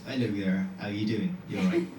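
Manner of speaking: broad Dorset greeting; Dorset accent. Shown as a demonstration. Comedian showing the Dorset accent they lost, broad Dorset. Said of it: "original accent is a Dorset accent".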